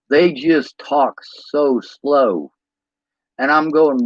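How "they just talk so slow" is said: very slow, drawn-out words; slow Southern talk. Retold or imitated. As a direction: stretch each word out lazily slow. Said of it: "you have to talk slower".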